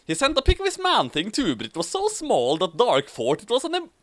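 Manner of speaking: ordinary delivery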